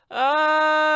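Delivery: long, high, loud held cry; hammed-up sustained "aah"; couples' scream. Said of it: "all couples are like".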